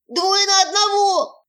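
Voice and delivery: high, slow wailing protest; wailing hut. Acted. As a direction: wail it like a sulking creature.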